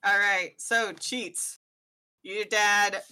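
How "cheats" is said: ordinary delivery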